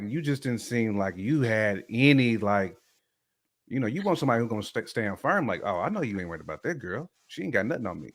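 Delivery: normal talking voice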